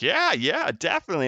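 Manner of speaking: high, bright-eyed yes from the wife; mock eager wife. Husband imitating wife's eager yes, high and light. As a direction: chirp it like an overeager wife. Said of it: "And she's like".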